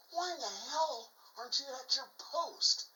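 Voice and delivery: high-pitched, incredulous captain's challenge; mock indignant demand. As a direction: demand an explanation, voice pitched well up. Captain confronts soldier who left post.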